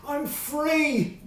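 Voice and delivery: a big, high, loud cry of release; joyful shout. A narrator's reading. Fred on the palace steps, shouting joyfully to the city. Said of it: "shouted with a big loud voice".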